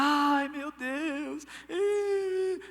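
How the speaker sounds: hammed-up funeral lament with a sob; mock wailing. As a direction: imitate a paid mourner crying over the body. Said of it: "e as mulheres começavam a chorar".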